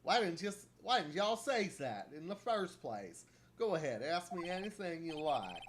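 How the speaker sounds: irritated Southern shopkeeper voice; Southern camera lady, annoyed. Acted. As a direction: tell off careless tourists in a drawl.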